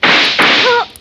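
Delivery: sharp high breathy gasp; a sudden shocked gasp of fright; startled cry. Acted. Rory finding Harry at the door, frightened gasp.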